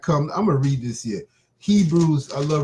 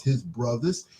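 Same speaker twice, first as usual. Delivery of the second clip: ordinary talk, nothing marked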